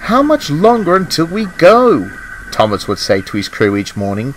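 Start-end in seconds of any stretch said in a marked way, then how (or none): 0.0-2.1 high, impatient question; eager. A narrator's reading. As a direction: ask it pitched up with eagerness. Thomas impatient and excited to go.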